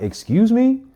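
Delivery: high, loud incredulous question; acted-out shocked retort; startled disbelief. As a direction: shoot the pitch right up, taken aback. Startled reply to gun in face.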